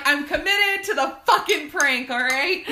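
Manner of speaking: mock fierce commitment. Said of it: "I'm like".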